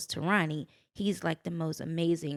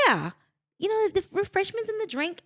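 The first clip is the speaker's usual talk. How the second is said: high-pitched, louder mock English accent; 13 semitones higher, 5 dB louder than usual. London friend explaining the phrase to her, imitated English accent. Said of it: "she has this amazing, you know, their accent".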